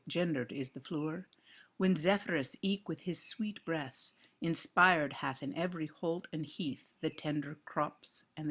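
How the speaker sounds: measured Middle English verse recitation; approximated Middle English pronunciation, deliberately paced. Shown as a demonstration. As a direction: recite it with old Chaucerian vowels, line by line. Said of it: "read this in as close to, uh, Middle English".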